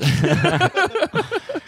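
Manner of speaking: high burst of real laughter; laughing. Real, not acted. Laughs at own silly nickname.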